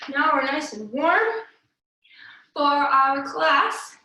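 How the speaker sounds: usual speaking voice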